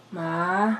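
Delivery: soft, low sickly call; feigned illness. Pretend. Child faking fever calls to mama, feebly. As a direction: call out weakly, playing sick. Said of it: "nilalagnat ako para di na ako papasukin".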